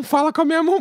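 high, teasing quote; teasing friend repeating the talk-to-the-hand line; sassy quote. Retold or imitated. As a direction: throw back her sassy line. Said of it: "Meteu um".

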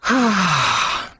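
long audible exhale; relieved sigh. Retold or imitated. Speaker recovering after the upset woman leaves, big sigh.

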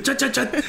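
high, repeated "chat" noises; imitated mic chatter. Joking about a streamer driving past, high imitated chatter. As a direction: mimic a streamer calling to chat, pitched high. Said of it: "hear him on the mic going past".

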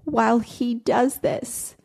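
a hint of a young girl confiding; imitating a little girl. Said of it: "And she said".

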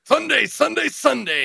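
high, loud stretched-out announcer call; over-the-top arena promo voice; hyped event announcer. Pretend. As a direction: boom it like a monster-rally ad.

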